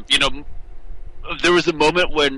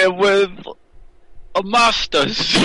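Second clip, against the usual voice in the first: put-on British accent; 6 semitones higher, slower than usual. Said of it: "British accent".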